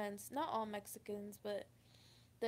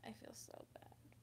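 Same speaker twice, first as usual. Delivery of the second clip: real, breathy near-silent regret, much softer; tearful whisper; 15 dB quieter than usual. Real, not acted. Confessing guilt to viewers while near tears, tearful whisper. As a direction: voice dropping away as tears come.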